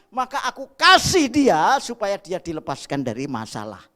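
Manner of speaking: loud, high, driving sermon voice; full-force preaching. Acted. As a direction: preach it out over the whole congregation.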